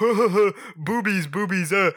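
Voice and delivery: hammed-up doofus voice; dumb guys. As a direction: play a dopey horny guy. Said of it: "the guys being like".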